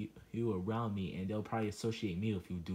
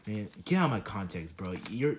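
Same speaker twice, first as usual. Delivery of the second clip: ordinary talk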